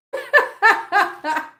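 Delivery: high, loud cackling laugh; laughter. Real, not acted. Owner amused by dramatic kittens, laughing hard. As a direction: burst out laughing.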